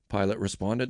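ordinary delivery, nothing marked

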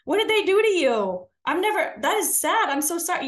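high, loud, sassy outrage; mock a fired-up church lady confronting the girl; indignant sassy confrontation. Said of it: "in my flesh, I would have normally wanted to speak up and be like".

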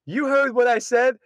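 high, insistent comeback; imitating a man standing his ground; defiant retort. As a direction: fire it back as if quarrelling. Acted retort in couple's quarrel.

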